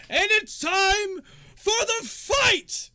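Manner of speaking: slow, high, booming shout; arena announcer. Acted. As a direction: launch the fight with drawn-out words. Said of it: "and then he goes".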